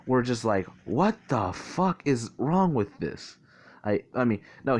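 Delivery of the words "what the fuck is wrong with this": slow, higher-pitched incredulous reaction; acting out stunned bewilderment; mock disbelief. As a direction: draw out the retold disbelief. Retold stunned reaction to family revelation.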